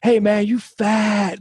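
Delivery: high, jeering taunt that climbs to a shout; mocking bully. Bully taunting the boy in the van, high mocking shout. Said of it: "And he's like".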